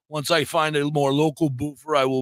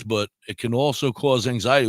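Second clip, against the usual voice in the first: ordinary talk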